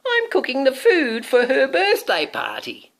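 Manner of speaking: high, loud squeaky witch; shrill-voiced storybook witch; squealing witch. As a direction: squeal it like an excited old witch. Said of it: "squealed the witch".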